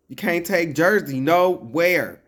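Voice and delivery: high, loud mock scolding of Jersey; exasperated yell at a rowdy relative; playful loud reproach. Real, not acted.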